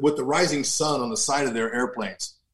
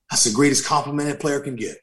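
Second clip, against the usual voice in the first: ordinary talk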